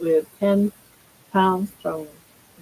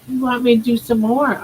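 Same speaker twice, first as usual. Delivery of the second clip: ordinary talk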